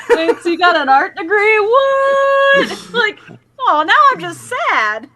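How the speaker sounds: high, loud mock-baffled cop, laughing. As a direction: ham up a cop sneering at an art degree. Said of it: "be like".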